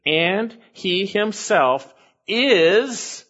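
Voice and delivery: very slow, rising up on is; emphatic rephrase. Shown as a demonstration. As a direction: stretch it out and leave is hanging upward. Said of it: "or you could say it this way".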